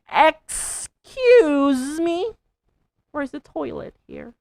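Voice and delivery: loud, high, stretched excuse me; exasperated. Pretend. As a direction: demand the toilet impatiently. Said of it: "you can exaggerate ... you're so mad".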